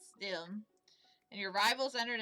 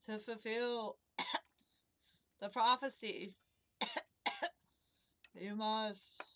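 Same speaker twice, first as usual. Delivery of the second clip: coughing through it; slower than usual. Acted. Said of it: "I'll work through it".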